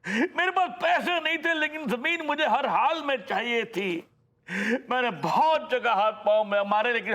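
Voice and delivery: high-pitched, emotional recounting of hardship; impassioned lament. Acted. Father telling daughter of his poor days, impassioned. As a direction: pour out an old struggle, pitched well up. Recalls his desperate struggle for land.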